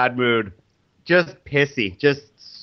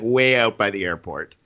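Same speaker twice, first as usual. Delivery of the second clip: ordinary delivery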